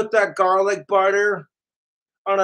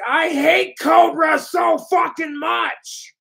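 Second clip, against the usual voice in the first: higher, louder, slow whiny mock shout; mocking troll rant; 8 semitones higher, 5 dB louder than usual. Streamer mocks his jealous trolls to viewers, higher, louder, slow whiny shout. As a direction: whine it like a bitter troll, overdone. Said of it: "They're like".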